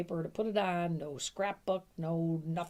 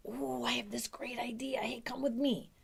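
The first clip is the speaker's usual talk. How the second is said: higher, whispered invitation full of secret promise; conspiratorial whisper; 7 semitones higher than usual. Shown as a demonstration. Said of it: "start with something like".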